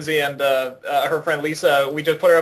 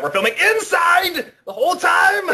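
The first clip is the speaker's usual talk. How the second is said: excited shout; 12 semitones higher than usual. Real, not acted. Filmmaker vowing to panel audience to shoot indoors, excited shout. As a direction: belt it out like a rallying cry.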